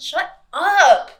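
loud teasing shout of shut up; playful shout. Real, not acted. Host shouting at co-host to stop guessing, loud and playful. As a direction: yell it at a friend who guessed right.

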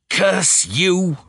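high, strained furious snarl; Davidson snarling. A narrator's reading. Unmasked murderer curses the detective, snarling. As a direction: snarl it through the teeth. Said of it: "snarled Davidson's voice".

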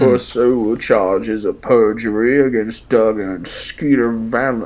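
a hint of a stern prosecutor, slowed; lightly voiced courtroom lawyer; mock lawyer.

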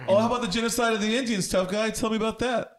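mock outrage. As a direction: challenge him with fake outrage. Mock outrage at tough guy.

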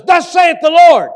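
prophetic proclamation. Retold or imitated. Prophet in boxers to the young pastor, a loud slow proclamation. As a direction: boom it out like a prophet.

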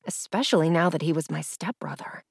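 ordinary delivery, nothing marked

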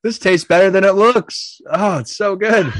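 high, lively fake delight over cake; mock delighted eater. Pretend eating cake.

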